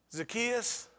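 Jesus calling up to Zacchaeus in the tree; calling up to him. Acted.

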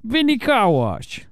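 slow gangster voice; mafia voice. Pretend. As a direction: drawl it like a mobster boss. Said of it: "I use my mafia voice".

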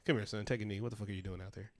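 low, soft coach pulling a player aside; a hint of a stern but kind coach; quiet coach talk. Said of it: "it was kind of like a".

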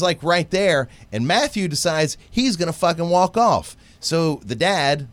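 normal talking voice

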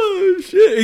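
high, loud startled oh shit; scared yelp. Retold or imitated. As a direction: yelp it in mock fright. Reacting to being threatened.

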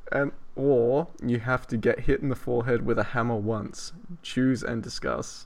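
slow, deliberate question read; slow, deadpan listener-question read; reading aloud. Acted. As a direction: read the question out with mock gravity.

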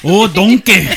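loud, hammed-up Scottish ogre; Shrek. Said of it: "I don't wanna butcher that accent".

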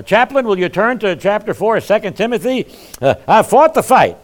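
a hint of a dying believer, high and loud; mock deathbed declaration. Dying man to his chaplain, mock deathbed declaration. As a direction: declare it loudly from an imagined deathbed. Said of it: "be on your deathbed and say".

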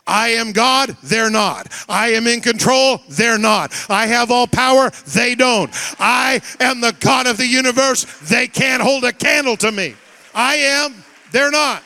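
fiery shouted sermon climax; preaching at full force. Real, not acted. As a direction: hammer each line home to the crowd.

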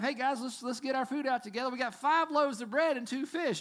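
high, loud, quick rallying call; rally the group around meagre food; eager disciples. Retold or imitated. Disciples rallying the others to pool food, eager call.

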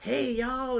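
high, loud mock Texas drawl; Texas accent. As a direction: laughingly imitate a slow Texas "hey, y'all". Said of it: "Texas folks sounding like".

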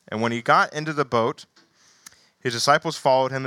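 animated scripture reading. Acted. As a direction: read the passage to the congregation. Reads Gospel passage aloud.

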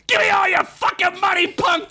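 high, squeaky shouted thug rat; squealing rodent mugger shout; yelling rat. Acted. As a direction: yell it like a tiny gangster rat. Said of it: "the rat yelled".

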